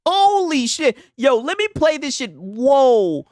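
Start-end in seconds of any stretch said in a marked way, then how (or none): none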